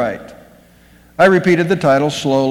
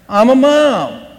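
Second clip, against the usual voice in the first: high, loud indignant snap; indignant retort; 6 semitones higher, 3 dB louder, slower than usual. Acted. Emily snapping back at the clerk, high and indignant. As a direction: throw it back at the clerk, proud and stung. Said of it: "snapped Emily".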